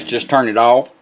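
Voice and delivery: ordinary talk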